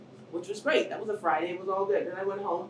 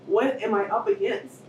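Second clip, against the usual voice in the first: ordinary delivery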